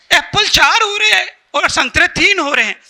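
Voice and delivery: incredulous, voice lifted at the start; acted bafflement. Teacher to student over wrong apple count, baffled.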